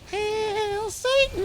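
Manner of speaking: very high, slow, cartoonish chirp; Miitomo voice. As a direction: chant it like a tiny cartoon avatar. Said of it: "a funny little, like, uh, Miitomo voice".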